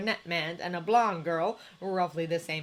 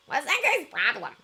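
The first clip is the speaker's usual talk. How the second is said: higher, louder, sneering question; Tomura's voice; 7 semitones higher, 5 dB louder than usual. A narrator's reading. Teen texting about the staring stranger, higher and louder. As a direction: toss it off like a cocky teen.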